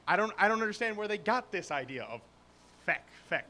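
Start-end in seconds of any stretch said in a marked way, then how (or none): none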